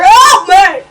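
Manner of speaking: high, loud scream for help; terrified scream. Acted. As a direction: scream it in panic. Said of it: "he screamed".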